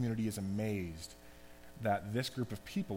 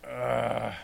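a louder, exasperated "ugh" groan; 5 dB louder than usual. Retold or imitated. Jesus fed up with demands for signs, mock groan. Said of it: "like, exasperated".

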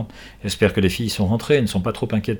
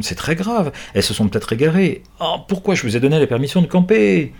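worried outburst; 7 semitones higher than usual. Acted. Owner panics over missing girls.

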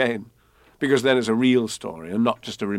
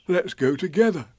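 gentle bear asking a friend; mild Pooh voice; lightly voiced Pooh bear; 7 semitones higher than usual.

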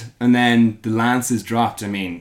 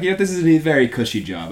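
put-on pleased young king; 7 semitones higher, 5 dB louder than usual. Voices young king enjoying idleness.

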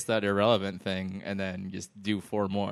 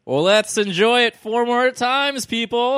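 big booming announcer, high and slow; fighting game announcer; 12 semitones higher, 10 dB louder, slower than usual. Acted. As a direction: boom it out like an arena announcer. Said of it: "I like your announcer voice".

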